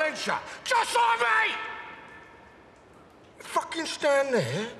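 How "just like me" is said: angry shout. Acted. Alfie shouts back at Tommy, outraged. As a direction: throw it back at him.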